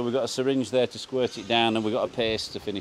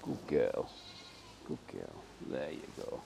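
very soft, low murmur; soothing praise; 10 semitones lower, 15 dB quieter than usual. Real, not acted. Farmer praises the foal after its medicine, hushed and soothing. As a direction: murmur it low to reassure an animal.

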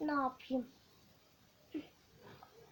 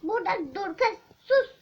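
shouting back and forth, louder; loud quarrel; 6 semitones higher, 9 dB louder than usual. Acted. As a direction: fight it out, both yelling. Kidnapper and girl quarrel.

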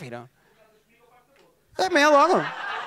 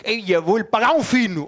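loud, delighted local fan gushing over the comic; gushing Porto fan; 4 semitones higher, 3 dB louder than usual. Retold or imitated. Porto fan praising the comedian, loud and gushing. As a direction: rave about him like your best mate. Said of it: "Vocês ou adoram um gajo".

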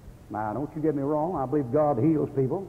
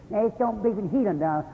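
mocking holiness people; 6 semitones higher than usual. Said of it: "Every time you get talking like this".

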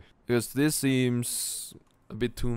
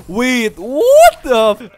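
gasping, high, loud shock; shocked shout; 13 semitones higher, 18 dB louder, much slower than usual. Real, not acted. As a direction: gasp then shout in disbelief. Sudden shock.

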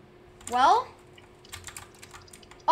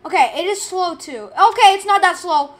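high, loud panicked yelling; 5 semitones higher, 12 dB louder than usual. Real, not acted. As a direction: shout while fleeing in panic. Chased by monster in game.

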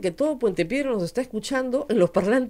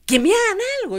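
high, louder retold challenge; defiant dare; 11 semitones higher, 5 dB louder, faster than usual. Woman retelling her standoff with police, defiant dare. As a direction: dare them, pitched well up.

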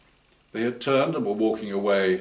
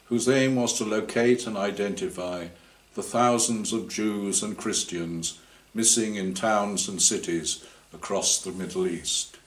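ordinary delivery, nothing marked

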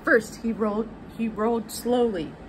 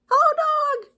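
cheery duck voice; 8 dB louder than usual. A narrator's reading. Duck calling out to Cow as he rides past, cheery duck voice. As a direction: call out high and loud as a little duck. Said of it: "said Duck".

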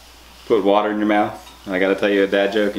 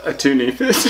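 laughing; 7 semitones higher than usual. Real, not acted. Guessing punchline while laughing.

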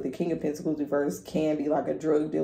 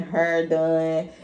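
ordinary talk

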